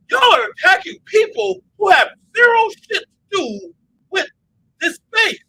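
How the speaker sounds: high, loud furious yelling; outraged shout. Retold or imitated. Speaker shouting at hypocrites who dox people in the space. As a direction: blast them for their hypocrisy. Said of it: "And I'm like".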